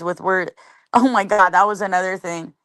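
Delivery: ordinary talk, nothing marked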